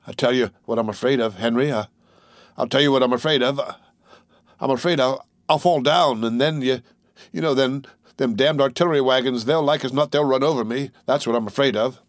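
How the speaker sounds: ordinary delivery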